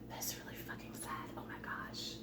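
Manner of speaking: a hushed, voiceless confiding aside; whispered aside. Real, not acted. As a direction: lean in and whisper it like a secret. Whispered aside after upbeat praise.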